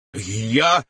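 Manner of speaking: speaking through a mouthful of food; mumbled round a bite; mouth full. A narrator's reading. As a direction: say it with a stuffed mouth, embarrassed. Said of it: "с набитым ртом покраснев".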